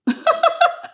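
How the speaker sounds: high-pitched amused laugh; real laugh. Real, not acted. Streamer amused by chat, high giggly laugh. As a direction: burst into a high, helpless laugh.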